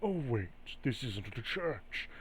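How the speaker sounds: soft, hushed taunting spirit; whisper in the ear. Acted. Entity whispers to Graves about prayer and offerings, hushed. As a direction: murmur it close, trailing away at the end. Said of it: "He whispers in your, in your ear".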